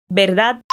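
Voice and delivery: "Verdad" with B sound, D almost mute; Spanish verdad. Shown as a demonstration. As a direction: say "Verdad" as "berdad", barely touching the D. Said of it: "O V tem som de B e o D no final é quase mudo".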